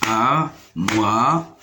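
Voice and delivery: "Armoire" in three separated syllables, ar-moi-re. Shown as a demonstration. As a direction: pause after each beat of "Armoire". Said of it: "se compose de trois syllabes". (« Armoire » syllabe par syllabe : ar… moi… re)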